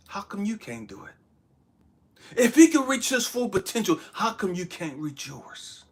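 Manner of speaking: ordinary talk, nothing marked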